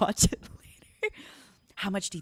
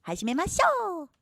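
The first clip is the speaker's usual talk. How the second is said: playful high greeting; 10 semitones higher than usual. Acted.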